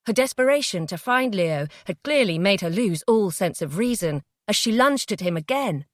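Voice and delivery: usual speaking voice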